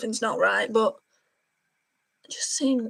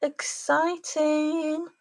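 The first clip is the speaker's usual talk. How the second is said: drawn-out excited; 5 semitones higher, much slower than usual. Real, not acted. Reacting to the red in eyeshadow.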